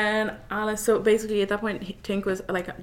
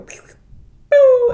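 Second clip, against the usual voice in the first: sperm sound effects; 17 dB louder than usual. Pretend. Mimicking sperm swimming sounds.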